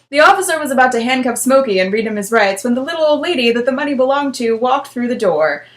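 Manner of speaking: ordinary delivery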